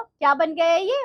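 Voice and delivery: usual speaking voice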